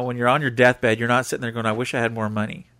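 ordinary talk, nothing marked

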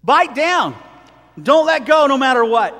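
hammered pulpit charge; preaching exhortation. Acted. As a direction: urge the church to hold on.

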